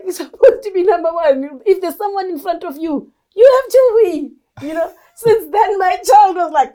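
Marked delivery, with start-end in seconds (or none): 0.0-4.3 high, emphatic motherly pressure; retold insistent demand. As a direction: push the child hard, rising higher and louder. Mother retells pushing her son.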